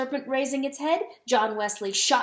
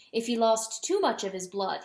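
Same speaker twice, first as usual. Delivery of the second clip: ordinary delivery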